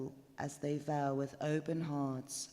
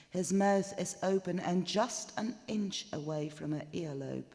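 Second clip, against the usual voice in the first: ordinary delivery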